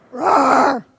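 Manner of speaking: loud growled roar; tiger roar. Shown as a demonstration. Showing the audience how tigers roar, loud. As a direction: roar like a big cat. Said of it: "And now we're gonna be tigers".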